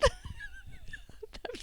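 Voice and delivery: high squealing laugh; burst of laughter. Real, not acted. Mother laughing at her daughter's cheeky joke, high squeal.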